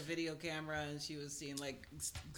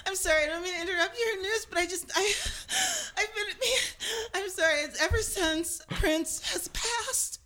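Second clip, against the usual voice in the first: fake crying; 6 semitones higher than usual. Mock grieving fan.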